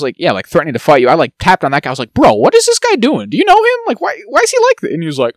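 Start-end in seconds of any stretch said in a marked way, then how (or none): 2.1-4.9 incredulous. Retold or imitated. Asking the friend what his buddy is doing, incredulous and high. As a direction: ask in disbelief, voice climbing.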